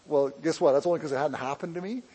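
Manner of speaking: usual speaking voice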